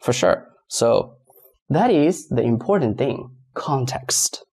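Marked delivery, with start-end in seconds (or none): 3.5-4.4 whispered. Shown as a demonstration. Teacher stressing the key idea to students, whispered. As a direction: whisper the word Context.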